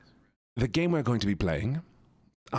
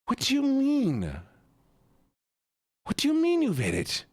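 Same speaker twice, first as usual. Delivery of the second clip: can't believe what you just heard; shocked disbelief. Real, not acted. Shocked at viewer's homework count.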